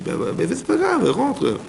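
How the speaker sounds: high-pitched, breezy "no big deal, come in"; soaring high mock voice. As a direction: jump way up high, airy and dismissive. God voiced welcoming him back.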